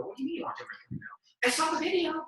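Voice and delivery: high, lightly voiced mom and son; retold phone dialogue. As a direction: voice both sides, lightly.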